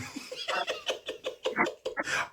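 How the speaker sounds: high breathy burst of laughter; big laugh. Real, not acted. Crowd laughing at the point.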